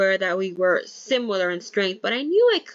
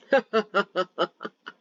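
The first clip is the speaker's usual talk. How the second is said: laughter. Acted. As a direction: burst of laughter. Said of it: "bursting out in laughter".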